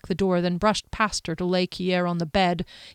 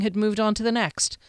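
plain reading voice

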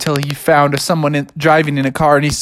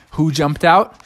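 ordinary delivery

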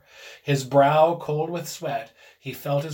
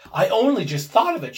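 high, loud, overwrought voice; hysterical; 6 semitones higher, 7 dB louder than usual. A narrator's reading. Wife explains her sudden idea to her husband, hysterical. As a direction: burst out in agitation. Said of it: "she said hysterically".

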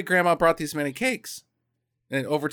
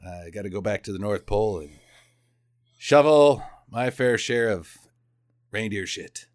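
mock tough hero; 8 semitones lower than usual. Elfman says goodbye to little girl, low mock stoicism. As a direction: play a stoic working man, low and understated. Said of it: "uh, he's like".

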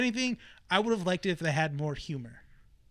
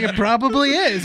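loud giggling aside; laughing; 6 semitones higher, 11 dB louder than usual. Real, not acted. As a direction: laugh through it. Laughing at own joke.

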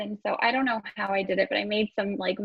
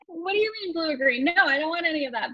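higher-pitched retold protest; mock exasperation; 7 semitones higher, faster than usual. Bride answering husband's question on colors, mock exasperated. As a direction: act out a baffled, exasperated refusal.

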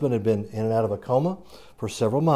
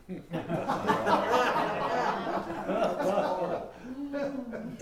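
long high laugh, softer; 10 semitones higher, 6 dB quieter than usual. Real, not acted. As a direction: chuckle at your own joke. Laughs at punchline.

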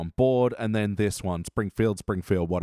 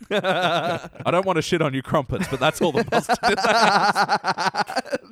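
laughing quote; 5 semitones higher, 3 dB louder than usual. Retold or imitated. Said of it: "New saying".

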